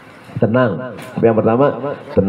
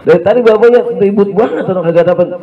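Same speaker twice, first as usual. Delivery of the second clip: 1 puzzled daughter; 9 semitones higher than usual. Retold or imitated. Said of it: "Anaknya melongo".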